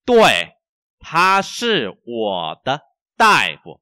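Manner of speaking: very slow, loud, word by word; language teacher's model reading. Shown as a demonstration. Teacher reads for learners to repeat, slow model reading.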